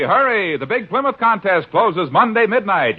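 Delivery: normal talking voice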